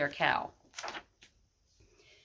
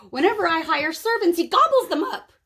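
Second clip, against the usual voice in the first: high, loud, put-on giantess cry; roaring giantess; 8 semitones higher, 6 dB louder than usual. A narrator's reading. Giantess begging Kate for help, high loud cry. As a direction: wail like a huge desperate woman.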